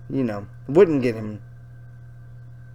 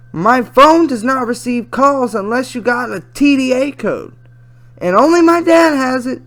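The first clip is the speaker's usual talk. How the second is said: higher-pitched put-on girl voice; chatty teen girl; 11 semitones higher, 9 dB louder than usual. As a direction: read her messages as a young girl, pitched up. Reads chat as teenage girl.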